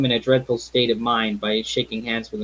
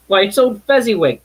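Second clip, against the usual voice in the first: a burst of joy, higher and louder; great excitement; 8 semitones higher, 7 dB louder than usual. Acted. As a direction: cry out in delighted recognition. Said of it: "Scrooge cried in great excitement".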